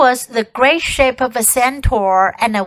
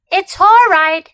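anxious, higher and louder; scared boy calming himself; nervous self-reassurance; 6 semitones higher, 5 dB louder than usual. A narrator's reading.

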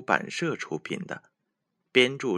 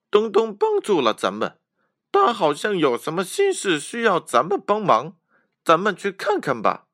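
put-on much higher, louder child calling friends together; rally the others to help; eager friend; 10 semitones higher, 6 dB louder than usual. A narrator's reading. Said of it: "于是他把其他小伙伴们都叫到了一起，说". (西西召集小伙伴，声音高而响亮)

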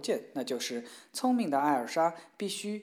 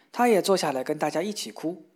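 usual speaking voice

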